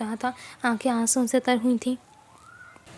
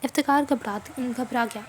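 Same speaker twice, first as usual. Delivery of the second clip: plain reading voice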